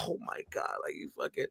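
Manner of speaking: hissed under the breath; angry whisper. Retold or imitated. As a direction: scold him without anyone hearing. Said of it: "Jeff is looking at me like".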